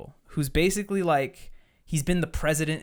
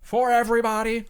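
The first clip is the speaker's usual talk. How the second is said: loud, higher-pitched politician parody; Donald Trump impression; 9 semitones higher, 7 dB louder than usual. Reviewer mimics Kenneth Marshall rallying followers, loud Trump parody. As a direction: proclaim it like a boastful rally speaker. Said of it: "He literally talks like this".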